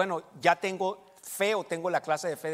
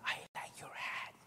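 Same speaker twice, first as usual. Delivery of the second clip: imitated furtive whisper, drawn out; slow whisper; 12 dB quieter, slower than usual. Older woman secretly praising a Trump hat, whispering slowly. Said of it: "me dijo así bien despacio en inglés".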